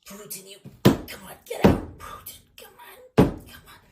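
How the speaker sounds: strained whispered pushing; mock Trump straining on the toilet; straining grunts. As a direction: grunt it out like you're constipated. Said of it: "trying to poop out".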